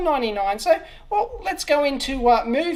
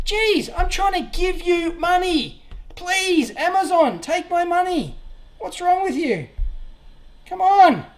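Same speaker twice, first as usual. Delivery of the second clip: exasperated pleading; 6 semitones higher than usual. Real, not acted. Tech vlogger begs Amazon to take his money, exasperated. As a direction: plead with the store, fed up. Error blocks his purchase.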